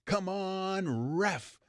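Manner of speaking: drawn-out complaint, a half-volume imitation of hollering; retold sideline yell. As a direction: imitate yelling at a ref, stretching the words. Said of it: "yelling at the top of my".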